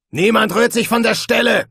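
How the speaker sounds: high, loud barked order; commanding shout. A narrator's reading. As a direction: bellow the order. Guard leader commands tavern.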